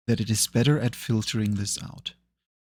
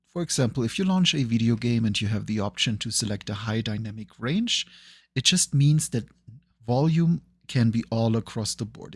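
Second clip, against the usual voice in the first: ordinary delivery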